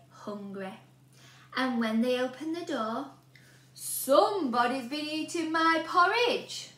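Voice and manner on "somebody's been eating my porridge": big, louder bear growl; growling Daddy Bear. A narrator's reading. Daddy Bear growling about his eaten porridge. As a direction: growl like a cross papa bear. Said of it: "growled Daddy Bear".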